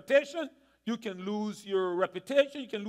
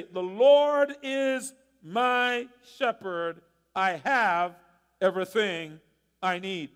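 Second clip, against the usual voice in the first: very slow, deliberate recitation with weighty pauses; slow affirmation; 3 semitones lower, 4 dB louder, slower than usual. Shown as a demonstration. Pastor demonstrates what he says when stressed, slow and deliberate. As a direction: savour each word like a personal creed. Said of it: "here's what I say".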